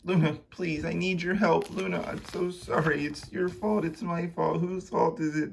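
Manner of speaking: high, desperate apology; pleading Konstantin. Acted. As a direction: beg for help, pitch held high. Konstantin begs Luna.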